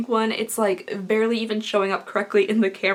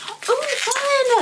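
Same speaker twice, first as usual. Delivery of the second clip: excited; 14 semitones higher than usual. Real, not acted. Delighted at an unboxed item.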